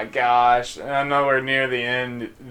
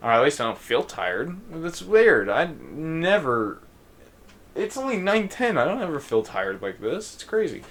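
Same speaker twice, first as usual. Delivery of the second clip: ordinary delivery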